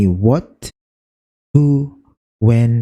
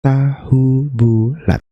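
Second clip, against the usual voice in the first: slow, evenly separated "ta-hu-bu-lat"; syllable by syllable; 3 semitones higher, much slower than usual. Shown as a demonstration. Teacher showing there are four syllables, syllable by syllable. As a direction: count out four beats in "Tahu bulat". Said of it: "Kan berarti ada empat".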